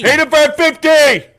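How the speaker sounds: high, loud announcer shout; hype call. Acted. Hyping a super chat.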